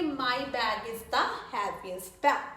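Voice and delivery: slow, word-by-word dictation pace; slow model sentence. Shown as a demonstration. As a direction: spell it out slowly for the class. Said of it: "तो हम क्या कहेंगे?". (धीमे, साफ़ उदाहरण वाक्य)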